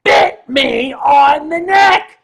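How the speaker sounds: slow, loud, wailing outrage; retelling the bite, slow loud indignant cry; outraged cry. Real, not acted. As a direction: drag out the words in disbelief. Outraged retelling of being bitten.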